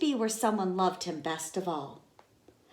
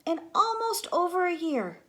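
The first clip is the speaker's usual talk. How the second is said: pitched way up; high lilt; 11 semitones higher than usual. A narrator's reading. Teacher reading to kids, high lilt. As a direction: lift the voice high.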